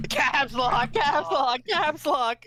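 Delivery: high sing-song teasing. Pretend. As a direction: chant it to tease. Teasing about caps lock.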